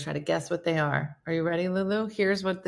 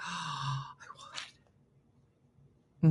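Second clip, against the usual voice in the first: gasping whisper; 3 semitones lower, 9 dB quieter than usual. Real, not acted. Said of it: "gasp then whisper".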